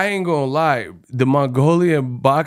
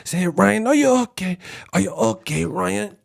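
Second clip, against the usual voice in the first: mock singsong quote; 5 semitones higher than usual. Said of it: "he said".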